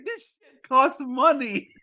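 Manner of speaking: high, laughing through the quote; can't keep a straight face; giggling mock captain. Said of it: "he's like".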